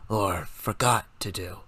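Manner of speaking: low hoarse aside; low soft hoarse voice. A narrator's reading. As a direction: muse it quietly. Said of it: "the pale one conjectured".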